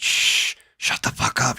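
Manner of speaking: urgent whispered hush. Retold or imitated. Tripping under the bed, whispering to his friend to shut up. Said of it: "hiding under bed, hushing friend".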